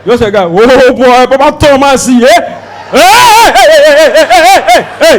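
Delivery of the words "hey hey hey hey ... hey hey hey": loud, hammed-up hey hey; rowdy mock greeting. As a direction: shout it like rough friends meeting. Mock men greeting each other.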